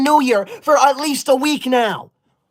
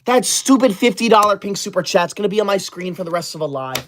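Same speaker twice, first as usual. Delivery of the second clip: ordinary talk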